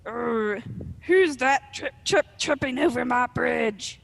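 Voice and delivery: loud, growling troll challenge; gravelly troll growl. Acted. Arg the troll challenges whoever crosses his bridge, gravelly growl. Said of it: "about to growl in his gravelly troll voice".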